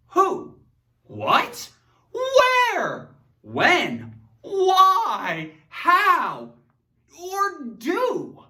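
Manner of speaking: each word flung out high and loud; hammed-up question words. Shown as a demonstration. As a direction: bounce through the list like a game show host. Teacher hamming up question words.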